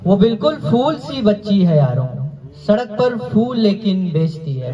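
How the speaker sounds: measured, projected mushaira verse; declaimed Urdu couplets; poetry recitation. Acted. Recites couplet again for audience.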